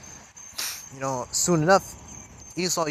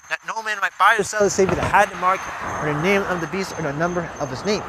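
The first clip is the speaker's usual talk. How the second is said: louder, declaiming the verses, slowing near the end; scripture reading; 3 semitones higher, 5 dB louder than usual. Acted. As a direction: thunder the warning out to the congregation. Reads scripture aloud.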